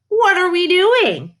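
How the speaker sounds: high, loud, bewildered question; baffled protest. A narrator's reading. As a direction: cry out in puzzled alarm. Dog baffled by new route.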